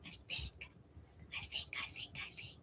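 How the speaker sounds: hushed, unvoiced repeated aside; whispering. Real, not acted. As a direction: drop to a whisper, unsure. Whispered aside after a doubt.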